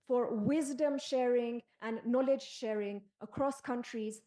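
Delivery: ordinary delivery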